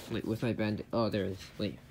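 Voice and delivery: normal talking voice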